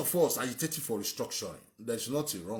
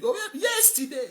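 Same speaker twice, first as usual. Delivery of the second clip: mocking the caller; 12 semitones higher, 4 dB louder than usual. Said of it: "He said".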